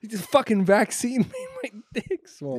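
cracking up while saying it; giggling out a silly complaint to a friend; laughing. Real, not acted. Laughing at his own joke.

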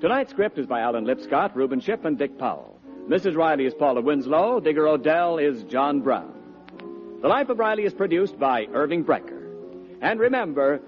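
ordinary delivery

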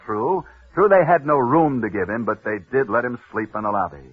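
ordinary talk, nothing marked

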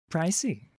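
high, light remark; high, appraising female character voice; cool pirate woman. A narrator's reading. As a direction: toss it off in a high voice. Heroine comments coolly.

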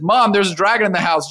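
insistent kid, playfully retold; story kid. Kid tells mom of the growing dragon, insistent.

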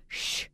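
drawn-out hushed "shh"; unfinished swear, just the hiss; cut-off word. Retold or imitated. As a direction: start the word and hold only its first sound. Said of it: "then he gave a long, silent pause".

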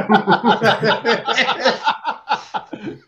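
big burst of laughter; laughing out loud. Real, not acted. Laughter at sleepless joke.

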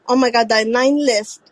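normal talking voice

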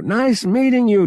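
high, loud, effusive goodbye; hearty farewell. A narrator's reading. Dale leaving, to Han, hearty farewell.

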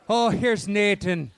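slow, drawn-out mock Irish. Podcaster guessing the title is an old English Nathan, hammed-up Irish accent. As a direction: put on a cartoonish Irish brogue. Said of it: "stereotypical Irish for that".

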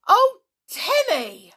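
high, loud, drawn-out cry; distressed yell. A narrator's reading. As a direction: yell at the dog in dismay. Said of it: "yelled George in distress".

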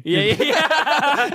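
high, slow laughing yeahs. Real, not acted. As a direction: laugh through the words. Laughing at joke.

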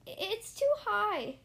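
high, slow, scared little voice; nervous child. Acted. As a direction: plead it from the top, frightened. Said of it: "she got nervous".

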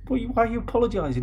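quick, high-pitched show of disbelief; retold bafflement. Player asking his opponent why, in mock bafflement.

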